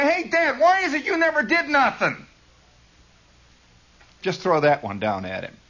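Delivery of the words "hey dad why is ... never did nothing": put-on high-pitched, needling kid's call; hollering. Shown as a demonstration. Kid taunting dad from upstairs, hollering. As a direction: fling the question down the stairs. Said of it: "go to the head of the stairs and holler".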